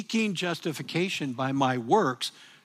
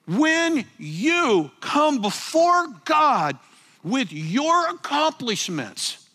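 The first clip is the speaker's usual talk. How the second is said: full-force preaching; 8 semitones higher, 3 dB louder than usual. Acted. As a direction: lean into every word. Preaching climax to congregation.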